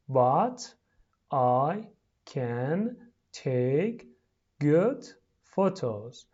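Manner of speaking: very slow, each word separate and rising. Shown as a demonstration. Teacher pronouncing for pupils at home, word by word. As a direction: stretch out every word with a gap after it.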